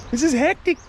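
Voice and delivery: excited. Real, not acted. Reacting to snake in engine.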